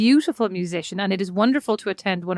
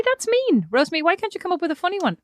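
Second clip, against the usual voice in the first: normal talking voice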